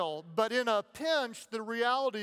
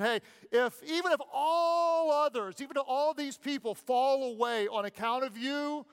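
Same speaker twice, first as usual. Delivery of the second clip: pitched-up pleading promise; earnest vow; 4 semitones higher than usual. Acted. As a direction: swear it with your whole heart, rising high. Peter's vow acted out.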